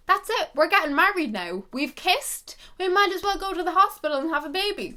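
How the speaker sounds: put-on giddy child. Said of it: "I was like".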